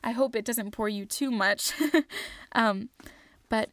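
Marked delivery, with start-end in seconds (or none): 1.8-2.5 light embarrassed chuckle; short laugh. Real, not acted. Self-conscious laugh after reading.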